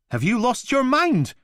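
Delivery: very high, loud outraged yell; indignant shout. Acted. The Mantis yelling back at Blakey, outraged. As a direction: fling it back at him.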